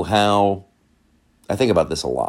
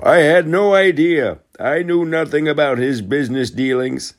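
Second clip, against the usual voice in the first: Biden impression; 8 semitones higher than usual. Quoting Biden on campaign trail.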